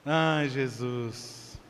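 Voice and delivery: very slow, soft swooning sigh; dreamy sigh. Pretend. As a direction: sigh it out like a smitten girl.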